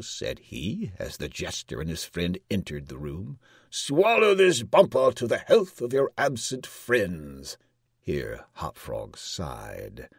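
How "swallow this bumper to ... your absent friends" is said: loud, raised royal order; commanding king. A narrator's reading. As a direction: press a drink on the jester as king. King orders jester to drink.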